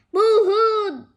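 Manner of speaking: loud high fake boo-hoo; mock sobbing. Teasing friend, loud mock boo-hoo. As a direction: ham up a fake sob.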